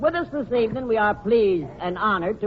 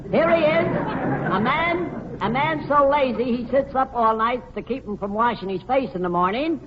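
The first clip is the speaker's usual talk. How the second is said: host introduction; 4 semitones higher than usual. Acted. Host presenting George to the audience, high showman announcement. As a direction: build up the guest's entrance.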